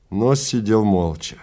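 plain reading voice

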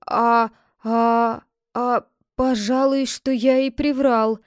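halting, drawn-out hesitation before admitting it; sheepish mutter. A narrator's reading. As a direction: mumble it reluctantly, stalling on the first sounds. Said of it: "пробормотал отец Тук".

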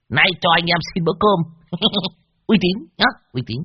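high, loud, brash hustler voice; brash haggler. Acted. Old Đồng pushing cash on the widow, high and brash. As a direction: slap down the deal, loud and cocky. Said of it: "hất hàm".

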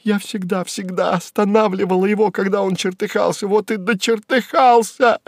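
high, overwrought woman's voice; high-pitched distressed outburst, rising further at the end; shrieked lament. Acted. Secretary tugging at the accountant's jacket, shrieked lament. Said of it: "и вскрикивала".

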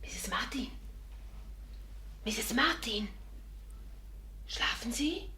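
leise geflüstert ins Dunkle; searching whisper. Acted. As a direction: vorsichtig rufen, ohne Stimme. Sucht im dunklen Zimmer. (Celia flüstert im dunklen Zimmer nach Misses Martin)

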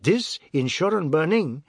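playful questioning lilt; light tone. A narrator's reading. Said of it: "and his tone was light".

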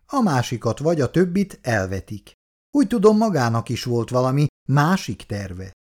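ordinary delivery

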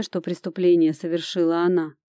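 ordinary delivery, nothing marked

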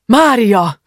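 loud, high cry of her name; shout. A narrator's reading. Husband cries out to his fallen wife, shouting. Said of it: "kiáltotta a férfi is".

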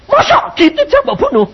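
very high-pitched disbelief; incredulous protest. Retold or imitated. Preacher objecting to God's threat to kill him, incredulous. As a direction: act out an appalled objection.

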